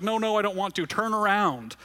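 put-on boy scared in the flight simulator, high pleading protest; panicked little boy. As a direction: play the frightened kid begging to stop. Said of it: "He's like".

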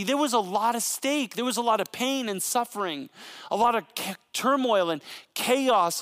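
impassioned sermon delivery building to a plea; preaching. Acted. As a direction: preach it out to the room with fervour. Pastor preaching to congregation.

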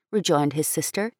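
ordinary delivery

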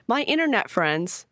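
ordinary delivery

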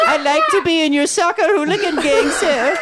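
Oliver Twist begging. Acted. Mocking millennials as Oliver Twist, high pleading beggar. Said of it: "millennial Oliver Twist, new character".

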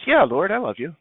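lightly acted, readily given yes; mildly acted eager reassurance; easy assurance. Peter's first, easy answer.

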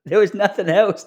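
a real laugh, then a line still laughing; comic laughs at his own LGBT joke. Real, not acted. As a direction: crack up at the punchline.

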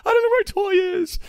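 put-on high whiny child's wail; mock-tearful little kid; crying kid. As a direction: play the upset kid who lost his toy. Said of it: "They're like".

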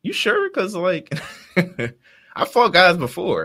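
high-pitched, doubtful cartoon hero; Goku. Goku answering King Kai's warning, high and doubtful. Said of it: "And Goku's like".

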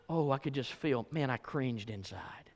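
ordinary talk

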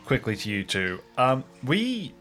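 ordinary talk, nothing marked

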